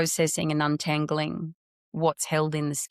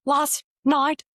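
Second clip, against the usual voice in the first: much higher, louder and slow, on the edge of tears; crying; 10 semitones higher, 6 dB louder, slower than usual. Retold or imitated. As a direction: push the words out through tears, pitched way up. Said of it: "and I'm really crying".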